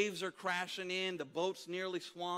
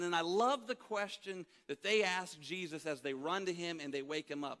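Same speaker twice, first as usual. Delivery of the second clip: ordinary talk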